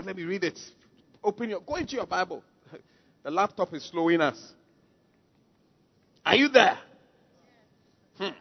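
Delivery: ordinary talk, nothing marked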